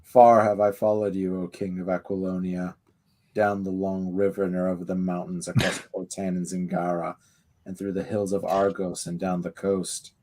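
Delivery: measured menacing priest; low solemn villain. Acted. Reads the Khitan's speech aloud.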